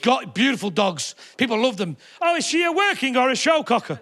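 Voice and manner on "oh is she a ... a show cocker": higher-pitched nosy questioner; a light take-off of an inquisitive stranger; mock dog-lover.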